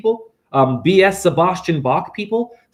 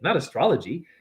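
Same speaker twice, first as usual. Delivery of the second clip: ordinary talk, nothing marked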